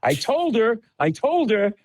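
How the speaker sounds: a boyfriend insisting he warned her, half-mocked; frantic exasperation. Retold boyfriend blaming his girlfriend, mock frantic. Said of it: "He's going crazy".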